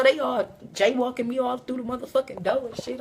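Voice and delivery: ordinary talk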